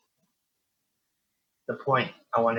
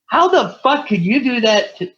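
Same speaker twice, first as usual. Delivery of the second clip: much higher, louder indignant outburst; retold angry cry; 12 semitones higher, 8 dB louder than usual. To his dead mom, outraged outcry.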